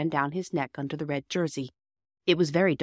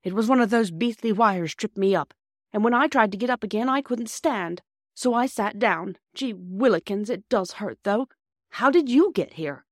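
put-on high-pitched boy recounting his mishap; aggrieved injured boy; 6 semitones higher, 4 dB louder than usual. A narrator's reading. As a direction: complain as the hurt schoolboy. Narrator voices the injured boy.

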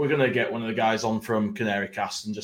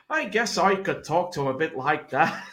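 higher, badly faked Norfolk lilt; bad Norfolk accent; 5 semitones higher than usual. Shown as a demonstration. Repeating the party piece for his mates, badly faked Norfolk. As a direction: muddle through a mock Norfolk accent. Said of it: "Go and do it again".

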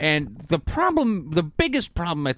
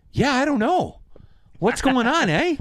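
clueless shrug; 13 semitones higher than usual. Retold or imitated. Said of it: "your leads are all like".

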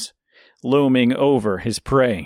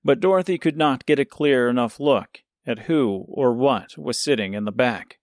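plain reading voice

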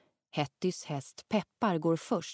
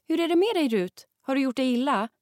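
worried; 8 semitones higher, 6 dB louder than usual. Acted. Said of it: "frågar mamma oroligt".

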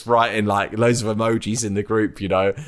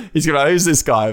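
higher, amused, with laughter; laughing mock quote; 5 semitones higher, faster than usual. Imagining [name]'s puzzled reply, higher and laughing. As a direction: toss off a jokey imagined reply.

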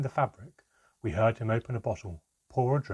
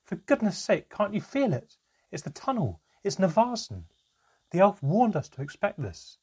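higher, louder cry to stop a fight; cried out; 9 semitones higher, 6 dB louder than usual. A narrator's reading. Mother stopping Handan and Manka fighting, loud cry. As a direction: break them apart with your voice. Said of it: "my mother cried".

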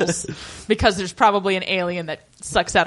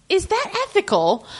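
mock prim question; 8 semitones higher than usual. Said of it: "fake Doris had been like".

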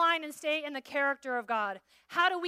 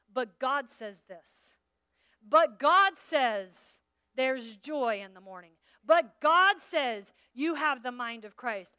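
slow, louder with each "But God says"; building proclamation; 7 dB louder, much slower than usual. Acted. Preacher rallying the congregation, slow and building loud. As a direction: build it up line by line.

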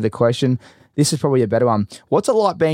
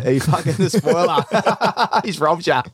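high, drawn-out, laughing repeat; 6 semitones higher, slower than usual. Real, not acted. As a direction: crack up while repeating the joke. Cracking up at guest's joke.

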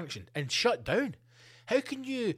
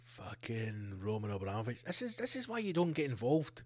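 muttered aside; 6 dB quieter than usual. Real, not acted.

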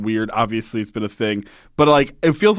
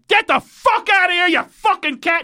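much higher, angry shout; furious yell at cat. Acted. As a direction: scream at the cat to get lost. Acted quote of man yelling at cat.

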